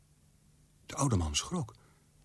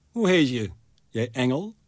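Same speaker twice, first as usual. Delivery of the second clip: louder, a scornful jab at the angel; mocking; 4 semitones higher, 6 dB louder than usual. A narrator's reading. Old man mocking the angel as he asks its name. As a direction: let the question sneer. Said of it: "Er lag iets honends in deze vraag".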